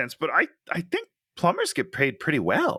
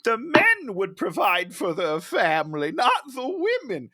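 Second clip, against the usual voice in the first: hammed-up grandma scolding about the old days; mock old grandma; 6 semitones higher than usual. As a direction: lecture like a disapproving grandmother. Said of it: "what the grandma says is".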